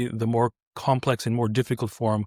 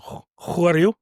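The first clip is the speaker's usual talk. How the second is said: put-on higher, louder Russian-accented challenge; imitated Russian guard; 5 semitones higher, 4 dB louder than usual. Russian guard stops a visitor, in a Russian accent. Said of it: "there's a Russian guard and he goes".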